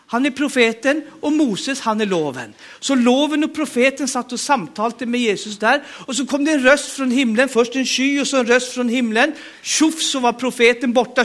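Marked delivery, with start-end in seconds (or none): none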